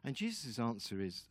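normal talking voice

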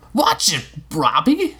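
put-on boy struck by a branch, yelping at his robot; cried out. A narrator's reading. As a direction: call out as the branch hits. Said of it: "he cried".

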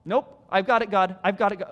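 loud, defiant nope to God; stubborn refusal. Retold or imitated. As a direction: push God's help away.

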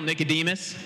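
usual speaking voice

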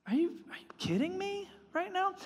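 imagining Jesus frustrated at the trap question; exasperated disbelief. Retold or imitated. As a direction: sigh it out like someone who can't believe the question. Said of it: "I see him kind of almost frustrated".

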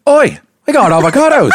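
put-on high, loud laughing New Zealand accent. Brother does a New Zealand accent on request, high and loud with a laugh. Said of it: "So I'd be like".

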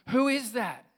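high, loud questioning call. Acted. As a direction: call up loudly to an unseen stranger. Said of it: "Joe calls out and goes".

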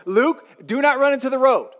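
loud and high warning; parent's stern command. Acted. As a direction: shout the warning to the child. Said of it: "That's how they are".

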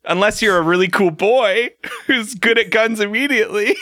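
high, smug mock lecture; hammed-up know-it-all with a laugh; cocky boy voice. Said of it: "Jamie's like".

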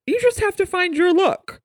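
high-pitched imitation of grandma; mock grandmotherly sing-out; grandma's advice.

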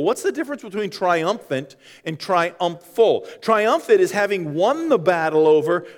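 usual speaking voice

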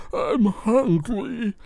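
high, drawn-out muttering character voice; Lucidia. Acted. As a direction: whine of hunger, very high and slow. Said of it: "like, muttering throughout the court".

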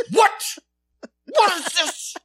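high, loud outraged disbelief; hammed-up shock. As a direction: play the scandalized Baptist parent. Conservative parents shocked.

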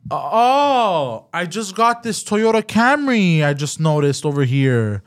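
high, slow, hammed-up surprise of the rental clerk; mock fake surprise. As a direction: play the clerk acting like he just found the car. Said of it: "he goes".

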